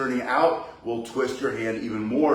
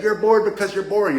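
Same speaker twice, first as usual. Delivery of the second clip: a higher, louder snooty teacher retort; mock teacher; 8 semitones higher, 5 dB louder than usual. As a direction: imitate a smug teacher putting you down. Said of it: "and the teacher said".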